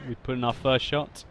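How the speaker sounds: normal talking voice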